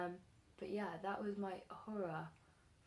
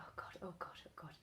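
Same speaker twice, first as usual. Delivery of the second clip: whispered, much softer frantic muttering; panicked whisper; 3 semitones lower, 8 dB quieter, faster than usual. Retold or imitated. Panicking quietly in her room.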